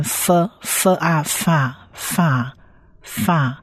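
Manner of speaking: "f" plus long "啊" blended into "fa"; slow sound blending. Shown as a demonstration. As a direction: draw out "fa" slowly, repeating with pauses. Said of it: "所以这个是长母音啊".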